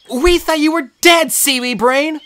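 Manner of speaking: put-on high, loud, slow scolding girl; a scared friend scolding in relief; high, loud tearful scold; upset Annabeth. A narrator's reading. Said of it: "making a scene".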